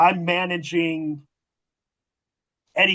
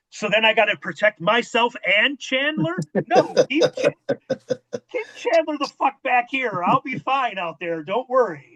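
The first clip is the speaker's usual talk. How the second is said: incredulous reply; 7 semitones higher than usual. Retold or imitated.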